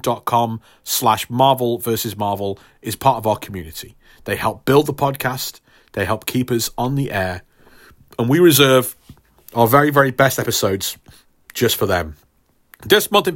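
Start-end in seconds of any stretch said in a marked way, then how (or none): none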